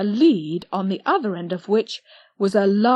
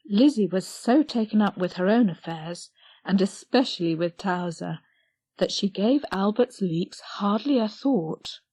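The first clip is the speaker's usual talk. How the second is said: ordinary delivery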